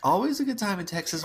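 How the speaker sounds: laughing catchphrase, high and quick. Real, not acted. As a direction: chant it back laughing.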